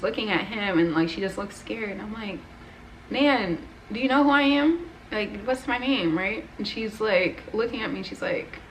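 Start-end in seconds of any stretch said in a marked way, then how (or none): 3.1-6.2 high, urgent question, loud at first; anxious questioning. Retold or imitated. Grandchild testing confused grandmother's memory on video, anxious. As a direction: press a loved one to recognise you, voice raised.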